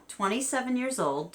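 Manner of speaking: ordinary talk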